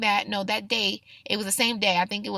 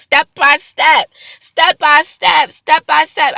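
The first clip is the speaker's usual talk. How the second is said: loud, slow, every step punched; emphatic; 5 semitones higher, 10 dB louder, slower than usual. Acted. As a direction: hammer each step, slowly. Emphatic repeated phrase.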